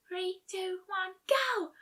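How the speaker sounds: high countdown yell. A narrator's reading. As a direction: shout the race start. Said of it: "Fluttershy yelled".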